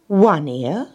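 lightly amused question; hiding a smile. A narrator's reading. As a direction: ask it with a suppressed smile. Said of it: "hiding a smile".

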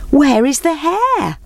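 high, slow calling question. Acted. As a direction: call across the clearing. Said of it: "they called out".

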